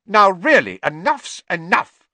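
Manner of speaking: loud, high reprimand; exasperated, emphatic telling-off; stern scolding. Acted. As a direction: put a firm stop to it. Teacher returns to scold noisy students.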